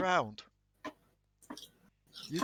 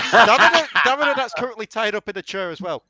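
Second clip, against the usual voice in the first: excited narration; 11 semitones higher, 19 dB louder than usual. Acted.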